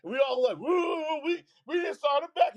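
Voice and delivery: ordinary talk, nothing marked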